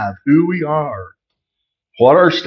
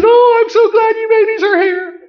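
gushing daughter. Retold or imitated. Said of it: "And Chloe says".

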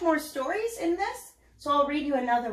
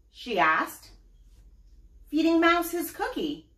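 put-on lively storybook reading for kids; story narration; 3 semitones lower, slower than usual. A narrator's reading. Mom reading a picture book to her child, animated. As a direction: read it out like bedtime to little ones.

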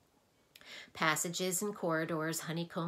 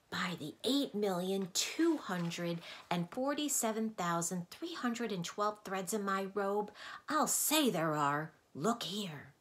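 usual speaking voice

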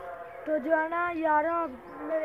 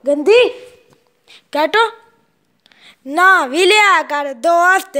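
much louder teasing scold of the dog; 3 semitones higher, 16 dB louder, slower than usual. Real, not acted. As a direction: tease the dog for being dirty.

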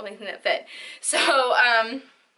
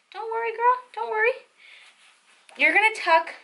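reassuring; 9 semitones higher than usual. Acted. Reassuring a worried viewer.

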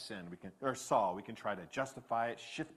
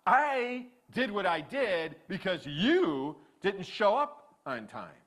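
slow and loud, "you" pushed up in accusation; blaming stress on you; 8 semitones higher, 10 dB louder, slower than usual. Retold or imitated. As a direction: lean on "you" to shift the blame. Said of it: "Saul is shifting the blame".

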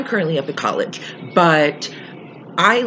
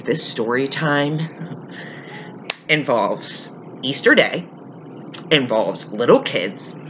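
slow, deliberate teaser delivery; slow dramatic build; 3 dB quieter, slower than usual. Acted. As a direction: draw each phrase out for suspense.

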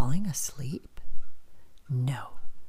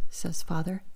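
plain reading voice

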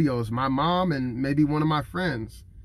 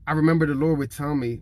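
ordinary talk

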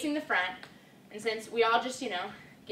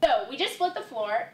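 ordinary delivery

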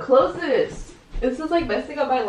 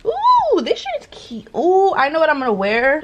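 ooh swooping very high with delight; 5 semitones higher than usual. Real, not acted. Picking her outfit, delighted by a shirt, very high ooh. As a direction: squeal over a cute find. Finds a shirt she likes.

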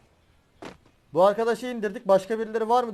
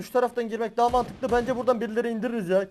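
normal talking voice

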